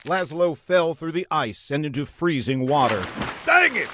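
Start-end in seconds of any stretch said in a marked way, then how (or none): 3.4-3.9 high, exasperated outburst; hammed-up high frustration; vexed villain. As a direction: curse your luck in a high voice. Villain falls into freezing water.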